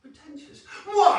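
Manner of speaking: hammed-up posh mother, offended; posh mum. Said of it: "she's actually quite posh".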